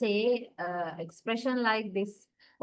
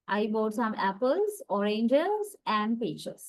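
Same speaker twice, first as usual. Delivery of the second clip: apples and oranges rising, peaches falling; listing intonation. Shown as a demonstration. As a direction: rise on the first two, drop on the last. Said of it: "raise our intonation at the first two items".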